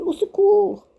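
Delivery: high desperate cry. A narrator's reading. Terrified boy cries out for his mother. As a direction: call for mother in terror. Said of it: "cria-t-il".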